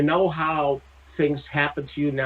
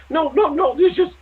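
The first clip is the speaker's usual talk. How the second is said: hasty denial; 13 semitones higher, 5 dB louder than usual. Retold or imitated. Julianne denies setting up.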